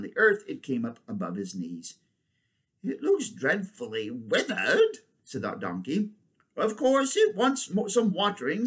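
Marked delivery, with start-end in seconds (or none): 2.8-5.0 higher, slow donkey voice; concerned donkey. Acted. Donkey figure in story. 6.6-8.7 very high elephant voice; confident she-elephant. Acted. Elephant figure in story.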